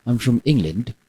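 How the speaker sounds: put-on English accent; English character accent. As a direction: play an Englishman called Giacomo. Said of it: "I'm going to choose a, uh, another character".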